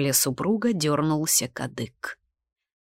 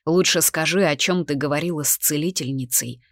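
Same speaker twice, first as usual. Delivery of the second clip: ordinary delivery, nothing marked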